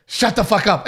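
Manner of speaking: rallying call; shouting to the table. Retold or imitated. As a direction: announce it to the whole table. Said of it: "look at the whole table and go".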